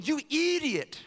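high-pitched cartoon scolding; Ren and Stimpy. Prophet mock-berates the servant who lost the axe, cartoonish scolding. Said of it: "That was my Ren and Stimpy impersonation".

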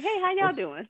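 high, sweet little hello; mock cheery greeting. Greeting everyone once the period starts, mock cheerful. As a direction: pop back in all friendly and high. Imitates cheery return to normal.

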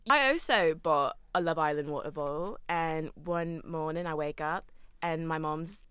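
put-on Australian accent telling an anecdote; Love Island Australian accent. Shown as a demonstration. Podcaster telling her water-bottle story on request, Australian accent. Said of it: "but do your accent".

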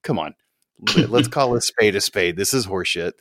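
ordinary talk, nothing marked

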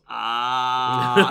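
long, loud teasing "aaah"; drawn-out loud jeer from a mate; mocking friend's "ahh". Acting friend's reaction to recognition.

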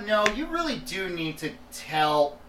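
ordinary talk

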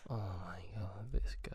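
soft whispered aside. Real, not acted. Mocking the guest on his phone, whispered. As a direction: breathe it under your breath.

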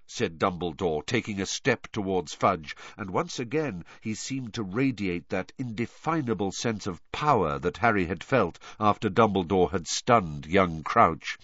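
ordinary delivery

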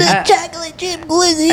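very high squeaky joke voice; silly voice. Pretend.

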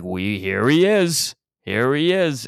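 hammed-up announcement of a rare sighting; mock excited discovery. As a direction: stretch it out like unveiling a marvel. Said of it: "and they're like".

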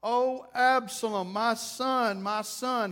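drawn-out wail for a lost son; grieving cry. Acted. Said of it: "He's screaming it".

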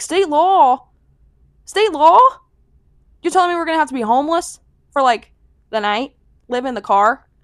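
loud, high, indignant retort; outraged disbelief. Retold or imitated. To the front desk clerk about the state law, loud outraged disbelief. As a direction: hit it high and loud, incredulous and fast. Outraged at hotel age rule.

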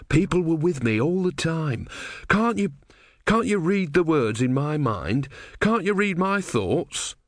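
high-pitched, pleading protest; flustered young disciple. Acted. Brother asks the god to read his mind, high and pleading. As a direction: plead upward, drawing out the questions.

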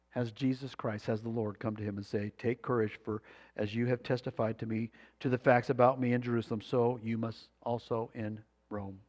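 soft, low retelling of the Lord's words; hushed comfort. Retold or imitated.

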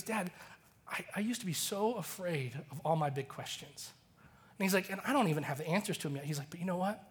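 put-on soft, hushed child's confession fading to a whisper; tearful boy. Son tells his dad he is not afraid anymore, hushed and tearful. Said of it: "his eyes fill with tears".

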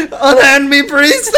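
high, laughing theatrical cries; hammy stage actor. Pretend. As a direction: overact a fleeing deity. Acting God hunted by priest.

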